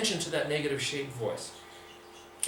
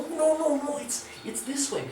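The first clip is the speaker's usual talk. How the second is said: high-pitched little inner voice objecting; negative shape voice; 11 semitones higher than usual. Acted. Inner voice correcting the artist's drawing, high-pitched protest. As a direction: pipe up high as a nagging inner voice. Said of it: "your negative shape voice is going".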